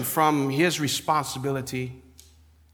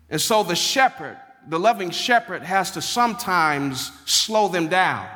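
preaching; 3 semitones higher than usual. Acted. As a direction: preach the verse with warmth and force. Preaching on verse two.

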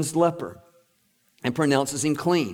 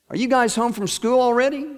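higher-pitched wife's voice; moglie che chiama; 8 semitones higher, 4 dB louder than usual. Acted. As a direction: call out a question from the back room. Wife in the back calls out.

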